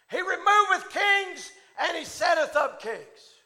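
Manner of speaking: loud, high, slow proclaimed scripture; preaching at full force. Acted. Preacher proclaiming Daniel's blessing to the congregation, shouting. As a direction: thunder the words of Daniel to the church.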